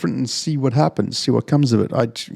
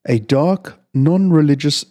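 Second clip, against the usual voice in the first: plain reading voice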